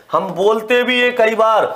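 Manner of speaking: usual speaking voice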